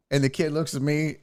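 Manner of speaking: normal talking voice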